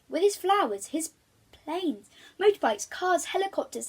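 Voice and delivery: rising, emphatic list of toys; building list. A narrator's reading.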